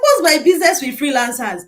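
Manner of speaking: mock-outraged retort to God; exasperated retort. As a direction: throw the question back, offended. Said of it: "So I'm like".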